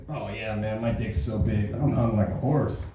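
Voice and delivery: a lightly voiced boastful dude; bragging guy. Comic telling a bar brag, lightly voiced boastful dude. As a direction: puff it up like a bragging bro.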